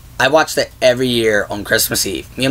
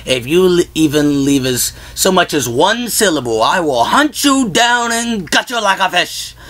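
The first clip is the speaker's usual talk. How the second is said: a menacing Grinch voice, rising higher, slowing down; Grinch threat; 4 semitones higher than usual. Retold or imitated. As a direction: imitate the Grinch's mock-scary message. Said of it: "The message he leaves on the answer machine is like".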